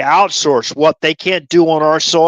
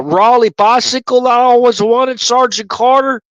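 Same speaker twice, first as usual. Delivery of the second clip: higher, wide-eyed country bumpkin, amazed; Gomer Pyle; 6 semitones higher than usual. Acted. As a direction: play a naive Southern private gushing at his sergeant. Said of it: "Gomer Pyle".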